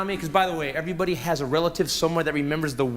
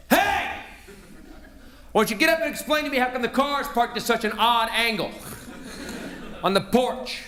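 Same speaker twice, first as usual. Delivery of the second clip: dad yelling at son; 5 semitones higher than usual. Retold or imitated. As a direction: play the angry dad waking his hungover kid. Father waking hungover son.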